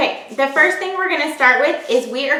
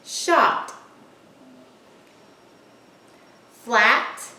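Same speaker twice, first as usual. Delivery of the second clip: dictation word list; much slower than usual. Shown as a demonstration. Dictating vowel words to children.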